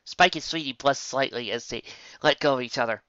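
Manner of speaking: plain reading voice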